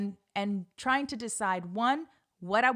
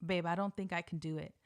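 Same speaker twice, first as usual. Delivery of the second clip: retold whisper; 4 semitones lower, 7 dB quieter, faster than usual. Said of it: "kept whispering to him".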